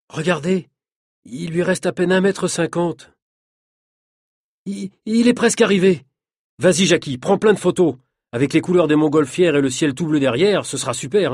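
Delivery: lifted, keen call to take photos; excited urging. Acted. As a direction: cheer the stunt on, a little breathless. Friend cheering on the daredevil.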